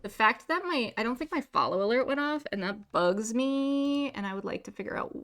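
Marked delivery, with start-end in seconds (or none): none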